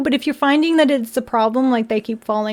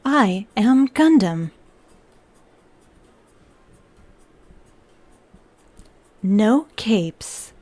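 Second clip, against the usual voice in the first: flat machine monotone; lightly voiced robot; 2 semitones lower than usual. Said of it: "I will say in the robot voice".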